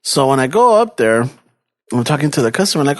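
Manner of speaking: ordinary talk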